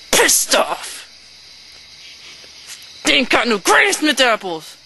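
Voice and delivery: ordinary delivery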